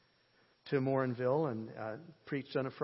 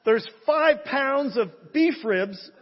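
much higher, louder, laughing mock prophecy; parody prophecy; 11 semitones higher, 14 dB louder, slower than usual. Preacher jokingly announces ribs to congregation, laughing high mock proclamation. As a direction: ham up a joke vision through laughter, slowly.

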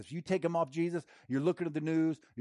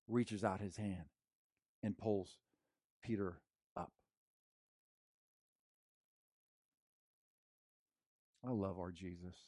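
tender; 7 semitones lower, 9 dB quieter than usual. Real, not acted. Tender close of sermon.

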